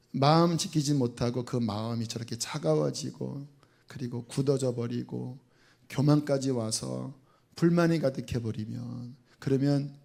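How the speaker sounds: usual speaking voice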